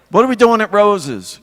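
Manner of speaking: high, loud imitation of dad; hammed-up dad pressing mother for the plan; dad's question. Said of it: "My dad would get up in the morning and he'd say".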